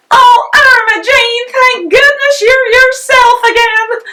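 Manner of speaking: put-on mother overjoyed to see her baby again, loud and high; relieved cry. A narrator's reading. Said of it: "cried Missus Potter".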